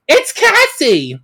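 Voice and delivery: high, loud and drawn out; big dramatic announcement of the rescuer; excited reveal. Acted. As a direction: announce the hero's arrival with a thrill. Heroine bursts in to save.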